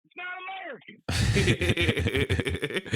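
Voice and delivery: mock an angry old conservative; mock patriot. Mocking conservative rant.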